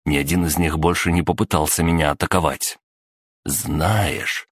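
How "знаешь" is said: ordinary delivery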